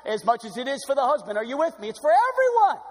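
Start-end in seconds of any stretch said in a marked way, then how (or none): 1.9-2.8 high, loud ringing climax; preacher crying out to the congregation, high and loud; preaching cry. Real, not acted. Shouted to the whole room.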